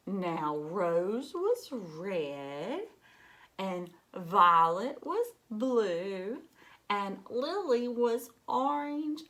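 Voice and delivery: slow, drawn-out rhyming read-aloud; slow storybook reading. A narrator's reading. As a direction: savour each colour for the children. Reads rhyming color lines slowly.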